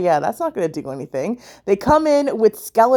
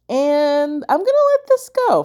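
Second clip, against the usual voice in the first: talking through a yawn, pitch rising high; high, yawn-stretched voice; 7 semitones higher than usual. Real, not acted. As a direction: let a yawn swallow the words. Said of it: "I just yawned in the middle of that".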